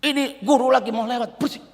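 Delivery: annoyed shushing. Retold or imitated. Disciples hush the blind man.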